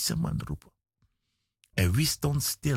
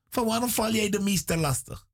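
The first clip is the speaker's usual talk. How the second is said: high, quick scolding quote; mock rebuke; 8 semitones higher, faster than usual. Crowd rebukes the blind man for bothering the master, scolding. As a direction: imitate the crowd scolding him. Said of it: "mensen bestraften hem".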